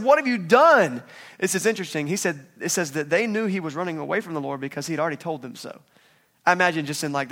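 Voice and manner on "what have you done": high, loud, slow horrified question; terrified sailors. Retold or imitated. Sailors confront Jonah over what he did, horrified high cry. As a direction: drag it out in shocked horror.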